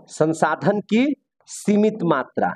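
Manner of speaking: very slow, with a pause mid-phrase; slow dictation. Real, not acted. Teacher dictating a point for students' notes, slow dictation. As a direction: spell the phrase out slowly for note-takers.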